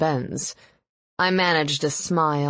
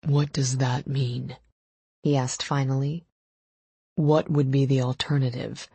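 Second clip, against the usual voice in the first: lover's wary question; 3 semitones lower than usual. A narrator's reading. Lover questions her, character voice.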